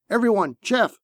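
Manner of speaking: high-pitched command; urgent order. A narrator's reading. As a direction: order the whole crew at once. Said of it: "Tomas ordered".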